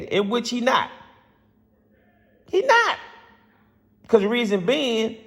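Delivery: real fan passion spilling over; passionate outburst. Real, not acted. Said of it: "I get so passionate".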